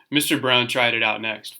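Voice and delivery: ordinary delivery, nothing marked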